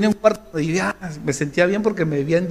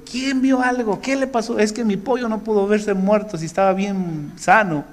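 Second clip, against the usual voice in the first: high, upset mother interrogating; mother questioning; 5 semitones higher than usual. Retold or imitated. Mother questions the children about the chick, high and upset. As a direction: demand answers like an upset mother. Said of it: "nos preguntó".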